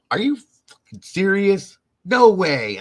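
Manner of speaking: imitating high, loud disbelief; mock shock, slow then bursting out; astonished. Brother just told the story, astonished.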